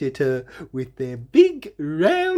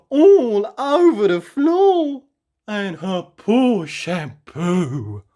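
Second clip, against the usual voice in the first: higher, louder, slow dismay; shocked narration; 5 semitones higher, 4 dB louder, slower than usual. A narrator's reading. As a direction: rise in horror at the mess. Dismay at bathroom mess.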